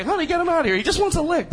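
high-pitched, fussing shop owner calling to his wife; mock shop owner. Shop owner telling his wife to get the customer out, high mock fuss. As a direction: play the owner shooing the guy out, hammed-up.

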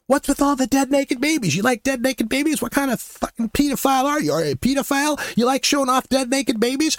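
high, jeering accusation; mocking shouts at the protester; retold shouting. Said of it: "I'm shouting this fairly loudly".

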